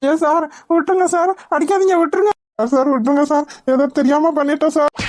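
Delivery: pained, pleading cries; desperate pleading. Acted. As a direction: beg them to stop hitting. Beaten kidnapper begs the police.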